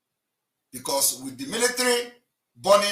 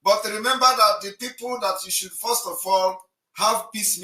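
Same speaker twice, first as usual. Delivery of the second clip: raised voice; 7 semitones higher, 6 dB louder than usual. Real, not acted. Heated argument on live call-in.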